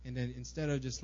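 normal talking voice

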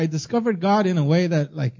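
normal talking voice

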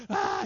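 very high, loud mock wail; imitated child crying. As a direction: shriek like a crying little boy. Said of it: "Ezra just cries up".

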